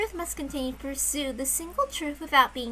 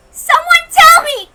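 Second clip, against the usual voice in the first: crying scream; 18 dB louder than usual. Acted. Girl cries out to the sky through tears, screaming. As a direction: sob out the demand to the sky. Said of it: "I cried out at the rainy night sky".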